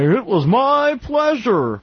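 high, slow, simpering politeness; mock smart worker. Mocking a worker who thinks he sounds smart, high and slow. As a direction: lay the fake courtesy on thick. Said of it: "saying things like".

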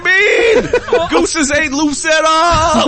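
high, slow laughing rant. Real, not acted.

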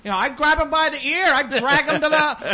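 high mock mother boasting; hammed-up mother bragging about discipline; mimicked proud mom. Escaped prisoner's mother on local news, hammed-up pride. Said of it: "the mom's going".

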